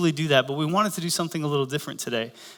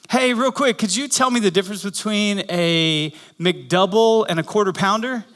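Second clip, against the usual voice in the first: higher-pitched imitation of a wife; wife calling out; 3 semitones higher than usual. Wife asks the drive-thru employee, mock calling out. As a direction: call the question toward the drive-thru microphone. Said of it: "yells into the microphone".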